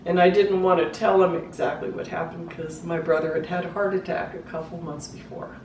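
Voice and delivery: ordinary delivery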